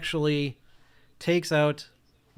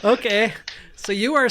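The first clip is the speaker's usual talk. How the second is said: laughing; 8 semitones higher, 7 dB louder than usual. Real, not acted. Still laughing after roll.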